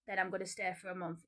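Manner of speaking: normal talking voice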